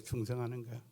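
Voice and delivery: quiet afterthought repeat of 충성하는. Shown as a demonstration. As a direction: let 충성하는 fall away quietly. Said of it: "목회는 그냥 충성하는 거예요".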